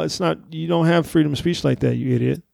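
ordinary delivery

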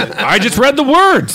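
high, drawn-out plea; slow comic wail; mock protest. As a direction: plead innocence in a hammed-up voice. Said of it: "That's when they say".

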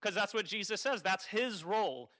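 usual speaking voice

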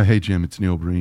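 low, monotone caller voice; Neil Breen. Acted. Calling Jim at odd hours, low impersonation. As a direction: drop into an odd, low deadpan greeting. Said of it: "that's a pretty good impersonation of Breen".

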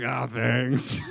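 hammed-up gratitude, caught off guard; loud mock thanks. Thanking the doctor who said I like you, loud and surprised.

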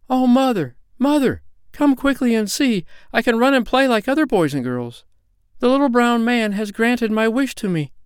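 put-on very high, jubilant little girl; excited crying out. A narrator's reading. Cured girl calls her mother to see, excited cry. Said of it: "she cried out".

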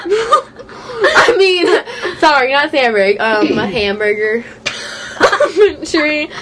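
laughing through the words; giggling while correcting herself. Real, not acted. Laughing at own slip.

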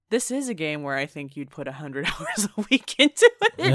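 words dissolving into a laugh; breaking into laughter. Retold or imitated. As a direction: laugh building through the words. Cracks up recalling first thought.

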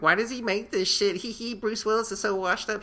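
high-pitched mocking imitation of detractors; mocking critics. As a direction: jeer it in a put-on high voice. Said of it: "everybody was just making fun of him".

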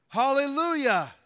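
high, loud shout of praise; crying out. A narrator's reading. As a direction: let it ring out over the room. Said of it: "Once more they cried out".

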